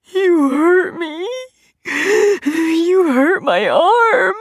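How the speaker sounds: high, slow, drawn-out wail; slow, high sobbing complaint; crying. A narrator's reading. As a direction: cry it out like a hurt child. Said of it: "Mikey cried".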